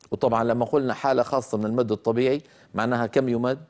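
normal talking voice

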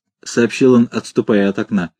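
ordinary delivery, nothing marked

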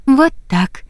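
put-on high, sweet girlish tone; sweet little voice. A narrator's reading. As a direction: coax the horse sweetly. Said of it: "милым голоском произнесла девушка". (милым голоском)

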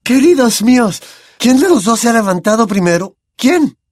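high anxious cry; alarmed seer. A narrator's reading. Trelawney cries out as the boys stand up, alarmed. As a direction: demand to know in alarm.

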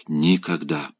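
ordinary delivery

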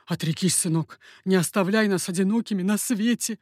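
understated, pleading cry; father's cry. A narrator's reading. Father grovelling, begging his son to renounce, crying. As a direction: beg your son, lightly cried out. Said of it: "кричал Меркандон".

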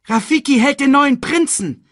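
high, loud crowd cry; excited announcement. A narrator's reading. Onlooker announces the new prince to the crowd, excited cry.

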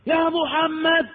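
high, drawn-out call of يا محمد; imitating a Bedouin calling; mock calling out. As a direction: call out to the house from the street, stretching each word. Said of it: "وقعد ينادي".

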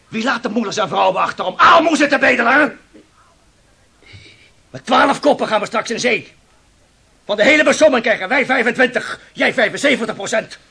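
luide, opgewonden beschuldiging aan de reder; boos verwijt. Acted. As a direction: verontwaardigd tegen de baas uitvaren. Ruzie van visser met reder. (boze visser die zijn reder aanklaagt)

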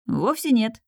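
ordinary delivery

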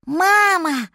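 high, loud cry to mother; little mammoth. A narrator's reading. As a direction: call out as a baby animal finding mother. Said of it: "сказал мамонтёнок".

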